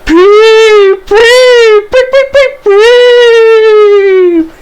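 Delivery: very high, loud imitated engine peeps; shrieking train-whistle peeps, last one held long; shrieked whistle. A narrator's reading. Percy whistles as a ghost, piercing high shriek. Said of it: "he shrieked".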